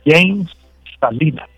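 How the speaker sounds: "James" as JAYMS, one syllable; English-style James. Shown as a demonstration. Giving the name he prefers, "James" said English-style. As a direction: pronounce "James" the English way, set apart. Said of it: "a él le gusta que le digan".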